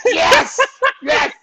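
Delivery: very high, laughing cry of yes; squealed yes through laughter; laughing triumph. Retold or imitated. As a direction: crack up while cheering. Cracking up at the retold praise.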